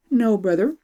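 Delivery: a hint of an eager young princess. Princess voiced.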